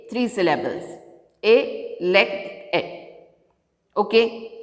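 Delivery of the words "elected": "Elected" split into e, lect, ed; syllable by syllable. Shown as a demonstration. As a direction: pause after each syllable of "Elected". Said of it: "Now I am splitting the words".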